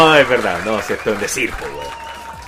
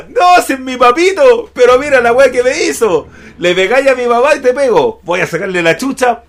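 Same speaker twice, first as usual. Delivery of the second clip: higher, louder angry threat; shouting daughter; 7 semitones higher, 5 dB louder than usual. Acted. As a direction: yell it at your brother. Stephanie voiced threatening loudly.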